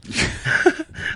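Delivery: a breathy, high giggle at his own joke; high breathy laugh. Real, not acted. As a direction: burst into airy laughter.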